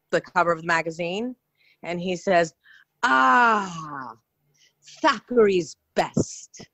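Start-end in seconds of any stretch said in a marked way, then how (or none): 3.0-6.7 slow, drawn-out "Ah", then a dry punchline; wry deadpan joke. Retold or imitated. Actor seeing a colleague's magazine cover, wry and bone dry. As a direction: imitate a wry wit savoring the quip. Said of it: "so bone dry".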